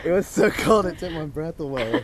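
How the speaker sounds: loud, laughing, out-of-breath telling. Real, not acted. Laughing after the cold plunge.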